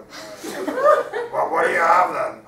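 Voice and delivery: loud laughing, words spilling out high. Real, not acted. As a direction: keep laughing through the line. Narrator still laughing.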